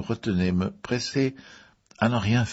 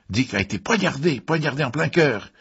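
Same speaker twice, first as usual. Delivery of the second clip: high, insistent revelation; agitated outburst; 7 semitones higher, 4 dB louder than usual. A narrator's reading. Elliot reveals his cousin was stabbed, agitated outburst. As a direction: raise the pitch, insist on the horror. Elliot interrupts with shocking news.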